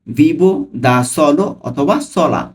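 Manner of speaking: Vivo দা solo অথবা সোলা said whole and slowly; slow full phrase. Shown as a demonstration. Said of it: "আমি কিন্তু ভেঙে ভেঙে অর্থ সহ বলছি".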